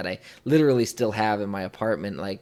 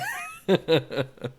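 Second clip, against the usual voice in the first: high laugh; 9 semitones higher than usual. Real, not acted. Laughing at the caption.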